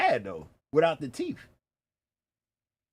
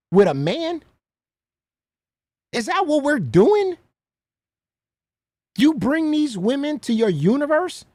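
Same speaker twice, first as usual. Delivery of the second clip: high, louder, rising disbelieving questions; incredulous indignation; 6 semitones higher, 4 dB louder than usual. Real, not acted. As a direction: call them out in outraged disbelief. Indignant questioning of the clip's speaker.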